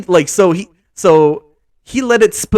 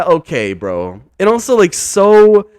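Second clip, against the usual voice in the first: ordinary talk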